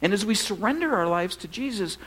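normal talking voice

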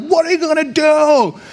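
high, panicky wail; mock freak-out. Pastor imitating himself panicking in someone else's crisis, mock freak-out. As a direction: pretend to lose your head. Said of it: "I'm, like, freaking out".

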